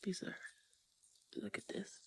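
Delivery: hushed whisper at the view. Real, not acted. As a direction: breathe it out softly. Quiet moment at the water.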